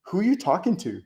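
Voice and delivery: puzzled talking-to aimed at himself; scolding himself aloud. Retold or imitated. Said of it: "I'll literally say it out loud".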